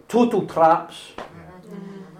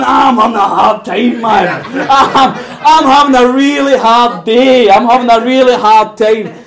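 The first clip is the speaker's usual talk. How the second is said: louder, higher, a hammed-up moan about a hard time; mock whiny complaining; 4 semitones higher, 6 dB louder than usual. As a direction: whine it like someone sorry for himself, half laughing. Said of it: "You're going".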